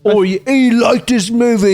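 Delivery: high, hammed-up British accent. Podcaster giving a verdict on the movie, high mock British accent. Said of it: "Our British accents".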